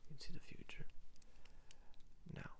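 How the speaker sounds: hushed, conspiratorial fortune-teller whisper; mysterious whisper. Acted. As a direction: lure the buyer in with a secretive hush. Mysterious seller offers visions.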